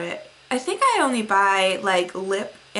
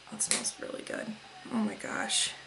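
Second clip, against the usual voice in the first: blissful whisper; 14 dB quieter than usual. Real, not acted. Unvoiced whisper, sniffing soap.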